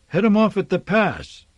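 big man yelling down the corridor; yelled. A narrator's reading. Engineer yelling to the running crowd, loud. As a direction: call out to head them off. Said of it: "yelled a big man".

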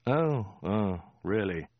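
slow, vague interest; absent reply. A narrator's reading. Khan still reading his maps, absent interest. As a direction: respond without really listening. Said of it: "said Khan absently".

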